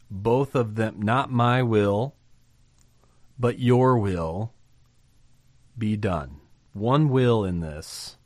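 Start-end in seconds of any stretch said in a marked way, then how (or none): 1.0-6.4 slow, earnest prayer. Acted. Preacher quoting Jesus' prayer.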